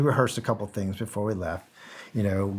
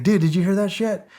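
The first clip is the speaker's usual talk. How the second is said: much higher, excited dude; bursting to share the chaos; amped-up question; 8 semitones higher than usual. Retold or imitated. Asking his teammate about the explosion, excited and high.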